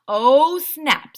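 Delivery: loud, high oh snap; shocked exclamation. Acted. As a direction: react to the spaghetti on his head. Ryan reacts to spaghetti dump.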